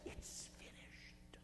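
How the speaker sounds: a faint, slow whisper with the last breath; dying whisper. Acted. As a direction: breathe the words out, barely there. Jesus's last words on the cross.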